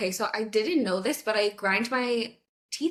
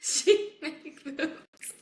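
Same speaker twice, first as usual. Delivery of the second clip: very slow, higher echo through laughter; laughing mimicry; 5 semitones higher, much slower than usual. Friend echoing the boyfriend's phrase, slow laughing mimicry. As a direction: repeat the broken English, cracking up. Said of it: "he kept saying".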